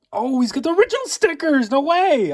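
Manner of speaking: high, loud, thrilled exclaiming; can't believe the find; real excitement; excited. Real, not acted. Spots original stickers.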